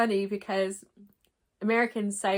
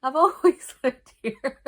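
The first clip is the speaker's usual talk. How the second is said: laughing son; 6 semitones higher, 12 dB louder than usual. Retold or imitated. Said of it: "And he's like".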